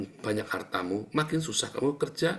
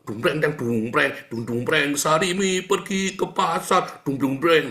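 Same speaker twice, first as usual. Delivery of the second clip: louder sung-out drum sounds; mock show music; 4 semitones higher, 6 dB louder than usual. Preacher mocks ministry as a show with loud chanted drum sounds. Mimics showy performance music.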